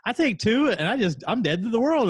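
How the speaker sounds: high, dazed imitation of the TV character; dopey TV character. Retelling a Netflix scene to friends, dazed high voice. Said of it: "and she goes".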